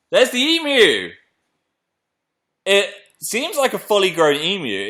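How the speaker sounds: high, loud cry of astonishment; excited reveal. Real, not acted. Host showing the emu to viewers, excited reveal. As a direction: crow it as the thing pops out. Emu finally comes out of egg.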